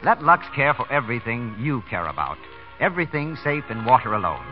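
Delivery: normal talking voice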